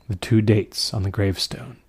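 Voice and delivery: ordinary delivery, nothing marked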